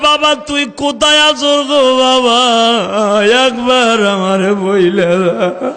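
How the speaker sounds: drawn-out lament, falling away at the end; mother's crying call. Retold or imitated. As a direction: wail for your lost son in a chant. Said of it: "মা চিল্লায় আর কয়".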